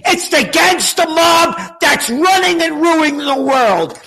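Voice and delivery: high, outraged shouting; furious rant. Real, not acted. Furious rant at rulers and critics.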